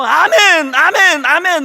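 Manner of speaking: loud rising amen calls; rally the crowd with each amen; shouted amen, amen; called amens. Real, not acted. Preacher leads congregation amen.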